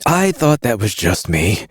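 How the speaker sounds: a queasy smuggler; Carrion voice. A narrator's reading.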